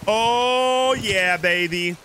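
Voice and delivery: thrilled, drawn-out cheer; real excitement. Real, not acted. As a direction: crow over spotting a target. Spots a boat to rob.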